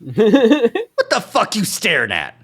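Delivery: high, slow, loud snarling weasel; grating nasal voice. Acted. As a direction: sneer it through the nose, laughing. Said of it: "Duke's grating, nasally voice".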